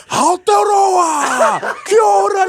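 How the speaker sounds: very high, stretched-out crowd call; hype man shout. Retold or imitated. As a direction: yell it to rouse a whole arena. Hyping the New Zealand crowd.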